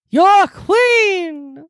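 very high, shrieked catchphrase; high squeal. Pretend. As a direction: squeal it out gleefully.

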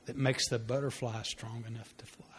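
hushed, breathy whisper; gentle whisper. Acted. Father whispering the lesson to his grieving son. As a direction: whisper it like a secret to a child.

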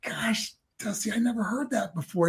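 soft, breathed reply; hushed amazement. Retold or imitated. Young wrestler answering his mentor, hushed. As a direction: say it barely voiced in awe. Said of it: "And I go".

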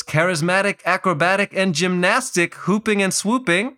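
sing-song broadcaster cadence; rising rhyming play-by-play voice; rhyming announcer quote. Retold or imitated. Quoting Clyde's rhyming commentary.

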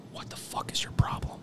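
hushed imitation of an angry boss; scolding done as a whisper; whispered mock scold. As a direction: whisper the chewing-out. Angry deputy chief retold.

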